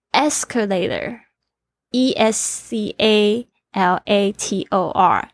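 slow classroom spelling, each letter set apart; slow teaching delivery. Shown as a demonstration. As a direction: say the word twice, then spell it out slowly. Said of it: "重音在第一个音节". (老师慢速示范拼读)

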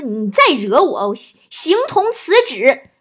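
slow, loud threat climbing high; hammed-up rage with a stumble; furious. Husband threatens his wife in an office quarrel, furious. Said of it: "老王就暴怒啊".

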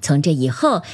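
plain reading voice

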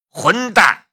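high, loud furious cry; angry outburst. A narrator's reading. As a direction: spit out the insult in rage. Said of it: "马小安愤怒地说道".

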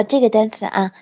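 ordinary delivery

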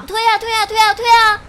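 high, crying taunt; tearful dare. Acted. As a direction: dare him through tears. Tearful dare to push her. (哭喊着挑衅)

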